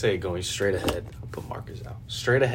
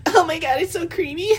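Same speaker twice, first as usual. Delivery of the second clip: much higher, laughing fake delight; mock ad voice; 6 dB louder than usual. Parodying a protein ad, high laughing mock gush. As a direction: gush over it like a silly commercial. Voicing the guy in the ad.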